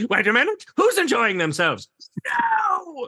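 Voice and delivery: scandalized spoilsport; hammed-up indignant scold; outraged killjoy. Mocking the fun police.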